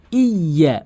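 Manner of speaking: "ইয়া" with a long held double y; doubled y. Shown as a demonstration. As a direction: lean on the y of "ইয়া", holding it before the vowel. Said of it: "ইয়ার যে তাসদিদ রয়েছে". (তাসদিদ দিয়ে "ইয়া")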